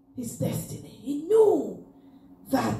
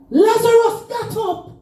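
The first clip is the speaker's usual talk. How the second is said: full-force preaching; 9 semitones higher than usual. Acted. Preacher calling the congregation to rise, sung-out shouting.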